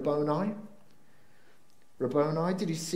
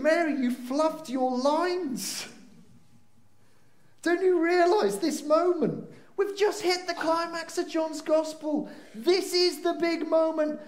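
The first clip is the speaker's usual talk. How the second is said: higher, slower mock scolding of Mary; exasperated scolding; 8 semitones higher than usual. Preacher scolding Mary for missing the climax, higher and exasperated. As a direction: chide her like a director whose actor fluffed her line. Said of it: "I was reading it this week thinking".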